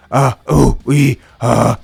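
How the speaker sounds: loud caveman grunts; caveman sounds. Shown as a demonstration. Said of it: "make the caveman sounds".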